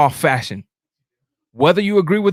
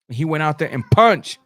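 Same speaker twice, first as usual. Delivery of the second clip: ordinary talk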